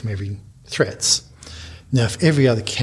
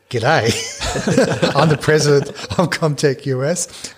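put-on broad Australian accent, laughing through it; thick Australian accent; 4 semitones higher, 3 dB louder, slower than usual. [name] greeting the Secret Service agent in a laughing thick Australian accent. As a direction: lay the Australian accent on thick, a touch higher. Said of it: "in a very thick Australian accent".

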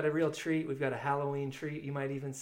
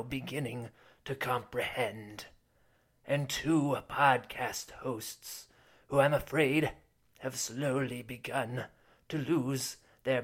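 spooky horror host. Acted. Spooky horror-host intro with music.

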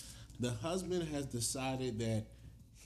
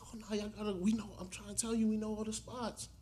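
high mock insisting; 9 semitones higher, faster than usual. Couple insisting.

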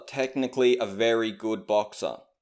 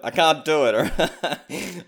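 laughing; 4 semitones higher, much slower than usual. Real, not acted. Laughs at own failed accent.

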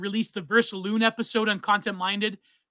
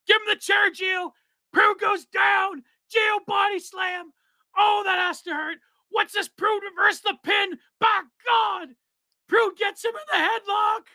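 much higher, shouted play-by-play announcer; wrestling announcer; 12 semitones higher than usual. Pretend. For the co-host, a shouted wrestling announcer call. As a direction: call the match like a frantic ringside announcer. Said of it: "do this in an announcer voice".

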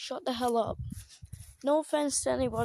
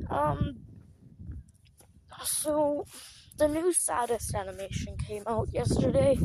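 ordinary talk, nothing marked

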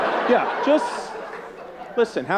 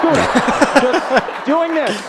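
higher, louder, laughing, slow on the last words; mocking disbelief; 7 semitones higher, 5 dB louder, much slower than usual. Comedian mocking a grown man on a scooter, loud laughing ridicule. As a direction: stretch it out in ridicule.

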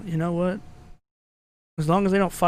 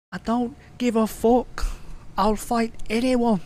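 higher, slow mock Scouser accent, tough talk; 6 semitones higher, slower than usual. Friend mocking a fighter's Scouser accent, hammed-up slow Scouser. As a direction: ham up a Liverpool Scouser brag. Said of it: "hear that Scouser accent".

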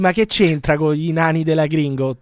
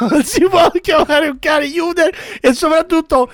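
high, pleading protest; excited protest; 9 semitones higher than usual. Real, not acted. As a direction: beg him to stop. Pleading over the dog.

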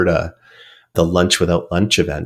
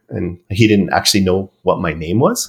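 ordinary delivery

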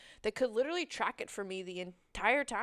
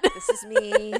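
laughing, much higher and louder; 16 dB louder, slower than usual. Real, not acted. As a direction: blurt it through a laugh. Laughing admission of guilt.

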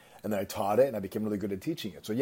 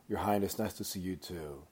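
downcast; 3 semitones lower, 8 dB quieter than usual. Retold or imitated. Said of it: "the farmer doesn't look up".

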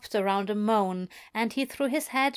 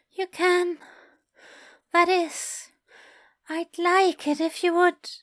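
higher breathless eager voice; Aziraphale voice; 8 semitones higher, 4 dB louder than usual. A narrator's reading.

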